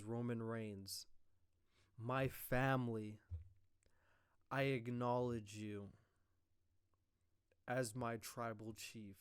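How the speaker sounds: slow, deliberate acknowledgement; grave slow Rock quote; slow and solemn. Retold or imitated. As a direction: weigh every word slowly.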